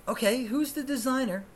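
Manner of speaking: high-pitched, persisting reporter; mock celebrity interviewer; red carpet interviewer.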